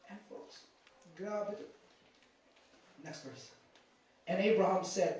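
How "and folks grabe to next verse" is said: dropping almost to a whisper; preacher hushing after a grim point; hushed aside. Real, not acted. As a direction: let it fall away, awed. Preacher drops to a hush.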